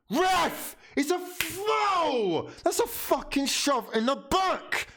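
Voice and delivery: high, strained appeal for a foul; yelling at the ref. Real, not acted. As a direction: scream up at the referee.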